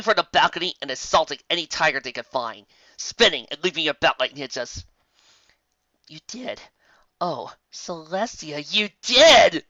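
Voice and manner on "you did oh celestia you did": thrill building to joy; from hushed to squealing delight; rising delight. Real, not acted. Listener overjoyed by the story.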